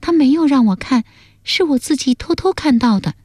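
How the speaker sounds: put-on high-pitched young woman's voice; daughter explaining. Daughter confessing she peeked, high-pitched explanation.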